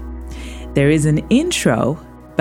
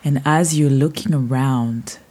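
guided meditation; 4 dB quieter than usual. Acted. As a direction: paint the beach softly, drifting. Guided beach visualization.

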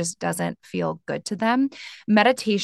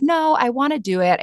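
higher, louder acted protest; lightly acted insistent objection; earnest insistence; 6 semitones higher, 5 dB louder than usual. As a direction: push back eagerly as a would-be student. Imagined meditator insists on trying.

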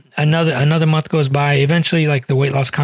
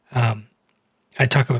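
normal talking voice

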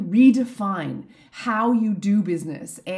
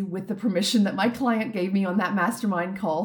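normal talking voice

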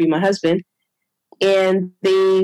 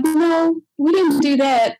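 defensive denial; 8 semitones higher, slower than usual. Retold or imitated. Staff denying a mistake.

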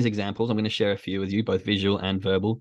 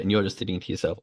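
usual speaking voice

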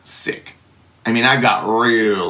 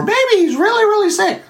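a much higher, put-on fretful voice; mock worried voice. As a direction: play up a fussing wife. Mock worried wife's thought.

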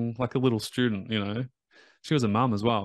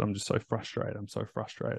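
frustrated client; 8 dB quieter than usual. Retold or imitated. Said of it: "she was very frustrated".

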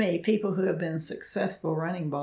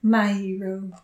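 rescued damsel; 5 dB louder than usual. Pretend. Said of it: "You're supposed to say "my hero".

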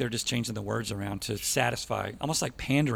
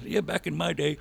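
higher-pitched mock old man voice; 5 semitones higher than usual. Host mocking himself for missing older country, old man voice. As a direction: put on a grumbling old-timer voice. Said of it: "I sound like an old man".

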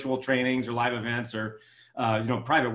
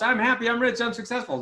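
mock affirmation; 8 semitones higher than usual. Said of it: "put it on the walls and sticky notes".

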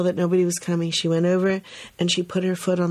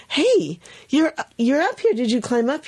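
light mock surprise, higher pitched; a touch of childlike excitement, higher; surprised kids; 6 semitones higher than usual. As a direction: kids calling up amazed. Kids calling up to her.

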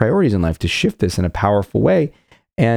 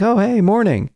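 cheery passerby; 10 semitones higher than usual. Pretend. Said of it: "And that person's like".

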